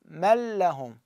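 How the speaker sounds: normal talking voice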